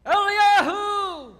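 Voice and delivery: loud, high, drawn-out call; long ringing shout for someone outside. Acted. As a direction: holler the name out the door, stretching it. Child calling Elijah at door.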